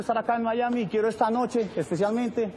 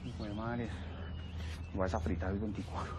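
muttered grumble; 10 semitones lower, 10 dB quieter than usual. Acted.

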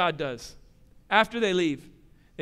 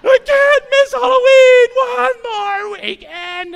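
much higher, loud crying wail; crying child tantrum; 13 dB louder than usual. Retold or imitated. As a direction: wail it like a kid losing it.

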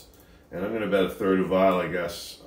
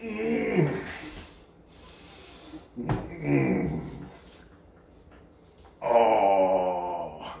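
pained hums; much slower than usual. Real, not acted. Eating burning hot food.

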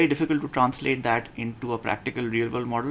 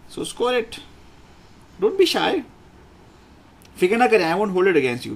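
encouraging; 7 semitones higher, 6 dB louder, faster than usual. Real, not acted. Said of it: "urging the class to score".

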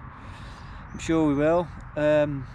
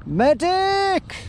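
man down shout; much slower than usual. Pretend. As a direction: yell for a medic under fire. Mock wounded soldier, calling for help.

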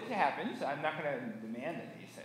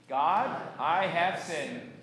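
slow, firm recitation, louder; congregational recitation; 7 dB louder, much slower than usual. Shown as a demonstration. Pastor leading congregation's confession, slow and deliberate recitation. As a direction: draw out each word for joint speaking. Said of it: "and say it with me".

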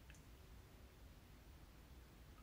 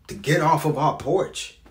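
raised, loud plea to a father; frustrated demand; 6 semitones higher, 9 dB louder than usual. Acted. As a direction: push it out high and loud. Wanted father to stand up.

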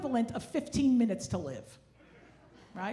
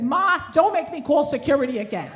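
exasperated; 4 semitones higher, 7 dB louder than usual. Retold or imitated. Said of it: "And I said".

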